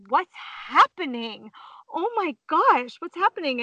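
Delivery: imitating kids' high-pitched surprise; retold wide-eyed astonishment; bewildered boys. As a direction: pitch it up, amazed and confused. Boys' bewildered reaction, retold.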